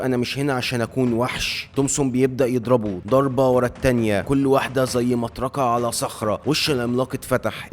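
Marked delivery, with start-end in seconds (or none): none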